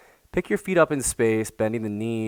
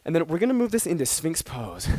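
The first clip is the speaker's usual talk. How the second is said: winded whisper; gasping the words out on no breath; 6 semitones higher than usual. Real, not acted. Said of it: "As I'm, like, out of breath".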